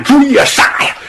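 high shouted chase cry; hammed-up call to give chase; mock battle cry. Qiao Mao shouting after fleeing bandits, a high mock battle cry. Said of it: "这就喊".

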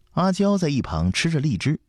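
ordinary delivery, nothing marked

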